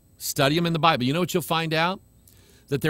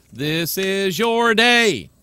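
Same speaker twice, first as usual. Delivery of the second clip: slow loud proclamation; 5 semitones higher, 5 dB louder, much slower than usual. Real, not acted. Praising his online viewers.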